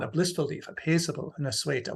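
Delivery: "leaf", "paisible", "sueta" in Middle English vowels; Middle English quotation. Shown as a demonstration. Lecturer quotes line one, "sueta" in Middle English. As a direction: say "paisible" as PAY-sible, "sueta" as SWAY-tuh. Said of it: "So in line one".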